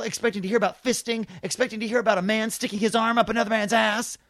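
imitating a furious theatergoer; ranting fury. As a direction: rail at him like an outraged patron. Furious man ranting in lobby.

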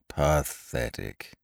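low, slow, sighing Eeyore voice; gloomy donkey. A narrator's reading. Old grey donkey sighs at his reflection, low and gloomy. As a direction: mope it out, dragging each syllable. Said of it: "said Eeyore gloomily".

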